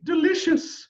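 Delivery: high, sweet delicious; sweetly. A narrator's reading. Queen coaxing her son, high and sweet. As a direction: coo it sweetly to the prince. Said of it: "she said sweetly".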